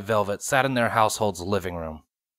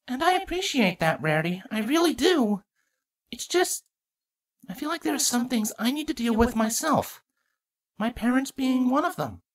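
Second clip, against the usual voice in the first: Twilight voice; 14 semitones higher than usual. Acted.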